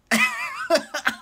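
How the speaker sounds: very high squeal of laughter. Real, not acted. Fan squealing with laughter at the twist. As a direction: squeal with glee.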